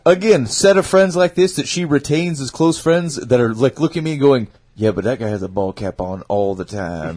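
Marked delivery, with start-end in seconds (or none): 4.7-7.2 imitating a friend, low and quiet; mock a doubtful friend in a lowered, softer voice; disapproving friend. Friends judging her date's ball cap, disapproving.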